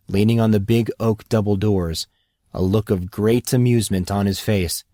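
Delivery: plain reading voice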